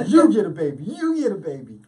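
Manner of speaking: giveaway host. Acted. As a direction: announce prizes to a crowd, hammed-up. Hands out babies like show host.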